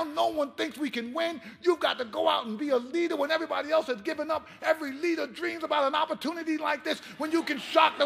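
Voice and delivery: high, loud rallying coach shouting; fired-up pep talk. Retold or imitated. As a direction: roar the locker-room speech to rouse him. Coach's pep talk in locker room.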